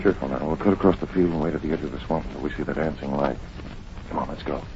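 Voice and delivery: hushed. Acted. Sneaking up to the swamp.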